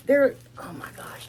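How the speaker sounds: breathy hushed oh my gosh; soft marvelling whisper; awed whisper. Real, not acted. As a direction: whisper it like you can't believe it. Whispering in awe at product.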